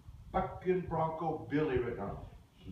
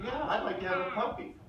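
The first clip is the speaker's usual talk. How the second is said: put-on kids agreeing; 8 semitones higher, faster than usual. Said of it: "everybody said".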